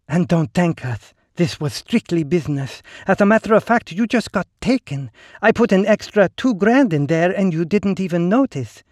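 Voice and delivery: high, silky and knowing; smooth woman's voice. Acted. Ligny character voice.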